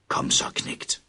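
whispered, cold beckoning; sinister whisper. A narrator's reading. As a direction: whisper it like a lure. (en uhyggelig hvisken)

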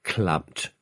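understated groan of disgust. A narrator's reading. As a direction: lightly groan it, grossed out. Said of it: "stønnede Jonas". (let stønnende og væmmet)

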